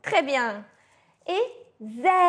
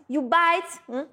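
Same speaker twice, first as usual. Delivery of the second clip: ordinary talk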